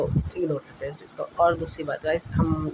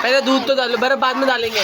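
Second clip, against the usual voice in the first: louder, higher insistent arguing; raised arguing voice; 8 semitones higher, 8 dB louder than usual. Acted. Insisting to his partner in the ice argument, raised voice. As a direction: argue your point, voice raised.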